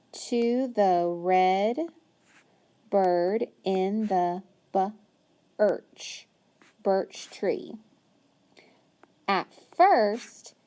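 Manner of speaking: very slow reading, each word drawn out; slow modeled reading. Shown as a demonstration. Teacher reading aloud to a child, very slow. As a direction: read slowly so the child follows. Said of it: "follow along as I read".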